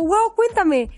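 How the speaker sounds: high, keen wow; imitating eager Dani. Mimicking her friend's eager reply, high and keen. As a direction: gush with curiosity. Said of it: "y siempre es como".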